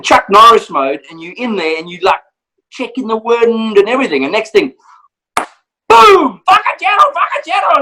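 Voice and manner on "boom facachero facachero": client yelling at a pig while stalking, a high sudden shout. Retold or imitated.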